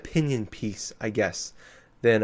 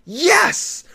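excited yes; 7 dB louder than usual. Retold or imitated. Celebrating with fist pump.

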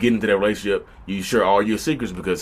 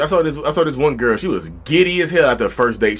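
ordinary talk

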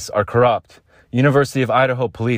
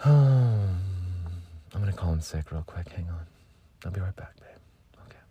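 much softer, fading to a whisper; hushed whisper; 2 semitones lower, 7 dB quieter than usual. Retold or imitated. Lovesick self whispering goodbye to a partner. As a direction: murmur it on the way out. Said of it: "That's me thirty minutes after waking up".

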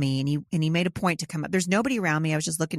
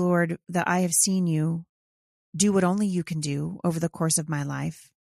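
prayer. Acted. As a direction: pray gently to the Lord, lightly.

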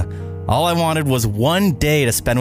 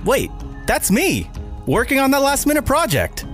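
surprised high exclaim; 11 semitones higher, slower than usual. Acted. Sees himself asleep at keyboard.

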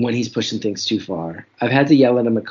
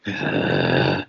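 deep frustrated growl from the chest; bear growl; 4 dB quieter than usual. Retold or imitated. Dad pushed too far by his son, deep bear growl. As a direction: let out a low bear growl. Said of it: "that bear growl come out of my dad".

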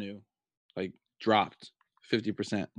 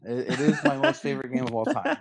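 sarcastic; 7 semitones higher than usual. Pretend. Ironic praise of Fortnite.

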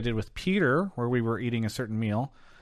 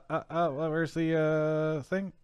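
ordinary talk, nothing marked

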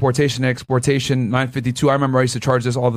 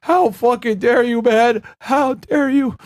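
mock crying; 6 semitones higher than usual. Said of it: "He's just crying".

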